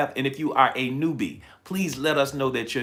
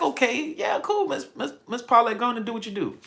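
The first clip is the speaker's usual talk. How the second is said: much higher, breezy mock-cheerful voice of herself; eager agreement; 10 semitones higher than usual. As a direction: play your past self saying yes sky-high and easygoing. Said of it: "I'm like".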